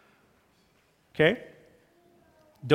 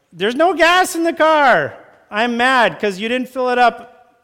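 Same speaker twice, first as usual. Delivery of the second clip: higher, louder annoyed griping; mock complaint; 8 semitones higher, 7 dB louder than usual. Spouse complaining about the empty tank, high mock annoyance. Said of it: "Complaining is like".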